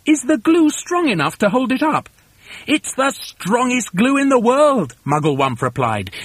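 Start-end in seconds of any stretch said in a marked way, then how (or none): 0.1-2.1 high, incredulous question; high-pitched worried query; anxious cry. A narrator's reading. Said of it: "they cried". 2.7-4.9 high, slow emphatic boast; high, drawn-out grand claim; proud boast. A narrator's reading. Boss boasts about the glue.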